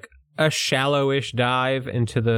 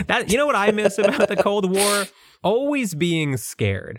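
mock nostalgia; 7 semitones higher than usual. Podcast host parodies the author missing the Cold War, mock nostalgic. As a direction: put on a wistful longing, slowing at the end.

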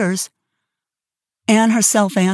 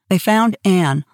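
usual speaking voice

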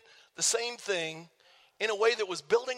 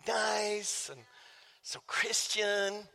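soft, drawn-out mock niceness; mock-sweet; 2 semitones lower, 7 dB quieter, much slower than usual. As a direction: stretch it softly, gently poking fun at piety. Mocking how nice it sounds.

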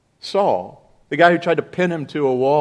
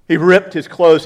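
ordinary talk, nothing marked

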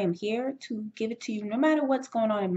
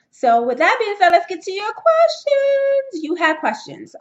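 much higher, loud, eager announcement; excited; 11 semitones higher, 10 dB louder than usual. Real, not acted. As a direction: burst in bright and loud, eager to start. Host launching into listener questions.

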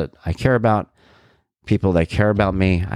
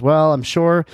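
lightly imitated, higher-pitched earnest reassurance; mock diplomatic small talk, higher; Jason Bateman; 7 semitones higher than usual. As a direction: politely keep arguing, pitched up. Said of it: "Jason Bateman goes".

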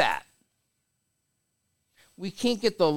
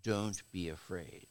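soft and low, tender comfort; gentle reassurance; 9 semitones lower, 12 dB quieter than usual. Retold or imitated. Jesus comforts his frightened disciples, soft and reassuring. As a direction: softly coax the frightened disciples up. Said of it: "He says".

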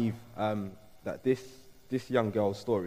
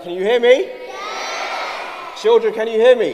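shouting; 13 semitones higher, 15 dB louder than usual. Real, not acted. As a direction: yell out to the whole room. Shouting to children in hall.

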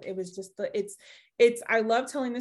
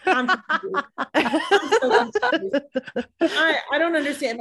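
high, louder, hammed-up confusion with laughter; baffled brother; 5 semitones higher, 6 dB louder than usual. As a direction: mimic a bewildered brother, half laughing. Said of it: "my brother was so funny".

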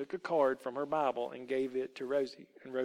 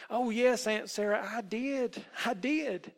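put-on high-pitched, eager child's assurance; earnest insistence; 8 semitones higher than usual. A narrator's reading. Rosie answers her aunt's doubt, insisting earnestly.

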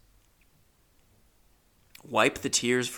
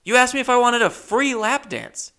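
loud, much higher indignant protest; mock outraged yelp, pitched way up; raised voice; 13 semitones higher, 6 dB louder than usual. Comic replays arguing with a dancer over the price, raised voice. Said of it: "I'm raising my voice now in the strip club".

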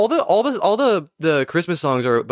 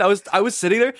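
normal talking voice

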